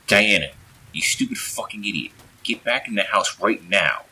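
loud, high, angry command; stern shouted order. A narrator's reading. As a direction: order them back at full voice. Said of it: "Aaron said sternly".

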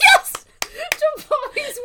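high, loud delighted yelp; excited. Real, not acted. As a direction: burst out with glee. Excited about the tangent.

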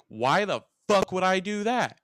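half-acted furious shout; retold scream. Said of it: "screamed it".

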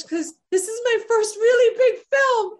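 high, loud earnest appeal; acted pleading. Acts out her plea to director.

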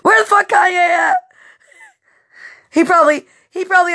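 high, loud yell; mock-frantic yell across the yard; frantic shout. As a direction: shout for the missing man in a panic. Frantic search in dream.

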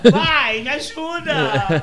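put-on child begging her father for help, loud and slow; drawn-out plea. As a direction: beg dad slowly like a little kid.

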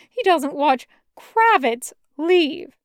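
ordinary delivery

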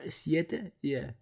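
ordinary talk

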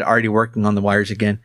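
plain reading voice

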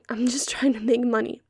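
ordinary talk

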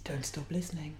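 breathy playful whisper. Pretend. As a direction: whisper it close to the mic. Whispering to a listener.

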